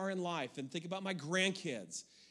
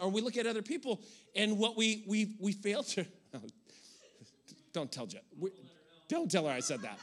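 normal talking voice

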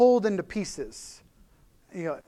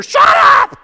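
screamed, much higher and far louder, hammed up; mock furious yell; 18 dB louder than usual. Frazzled wife yelling at her husband asking about dinner. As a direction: explode at him in a comic rage.

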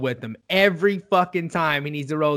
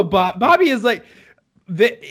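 usual speaking voice

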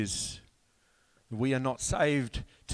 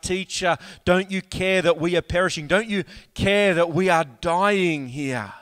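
higher, louder frantic plea; desperate cry; 5 semitones higher, 5 dB louder than usual. Acted. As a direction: cry out as if the boat is sinking. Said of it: "cried out".

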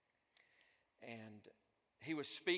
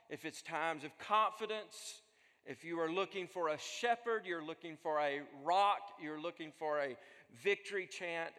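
rising, louder preacher's cadence, list after list; impassioned sermon crescendo; preaching at full force; 4 semitones higher, 6 dB louder than usual. Acted. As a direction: build it up like a pulpit climax. Builds to a preaching climax.

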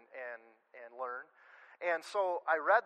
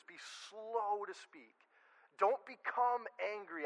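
drawn-out, soft "Be slow to speak"; slow, softened and higher pastoral phrasing; slow emphatic; 4 semitones higher, 4 dB quieter, slower than usual. Real, not acted. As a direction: stretch "slow" out gently, slowly. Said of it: "fight against those urges".

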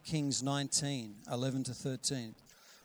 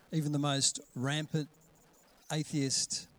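ordinary talk, nothing marked

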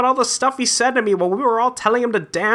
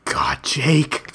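whispered; 7 semitones lower than usual. Acted. Exasperated aside about Jake.

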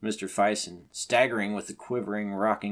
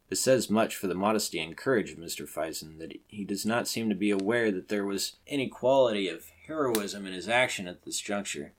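plain reading voice

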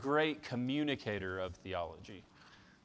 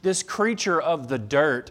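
louder and slow quoted preaching; preaching quote; 4 semitones higher, 7 dB louder than usual. Retold or imitated. Pastor retelling Sproul's rebuke to the crowd, louder and slower. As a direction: proclaim it slowly with weight. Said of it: "He says".

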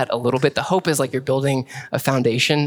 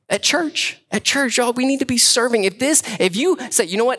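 fervent preaching; 5 semitones higher than usual. Acted. Preaching at full force.

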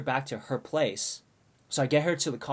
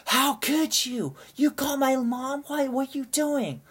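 much higher, slow, whiny accusation; betrayed drunk friend; 13 semitones higher, slower than usual. Retold or imitated. Drunk friend scolding the narrator for calling mom, high and whiny. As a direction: whine it out, feeling betrayed. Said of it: "she gets a little angry".

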